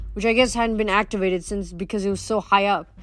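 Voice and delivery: ordinary delivery, nothing marked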